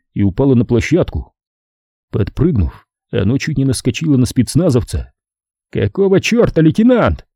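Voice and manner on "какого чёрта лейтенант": high, startled shout. A narrator's reading. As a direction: yell it out in alarm. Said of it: "прокричал Эверетт".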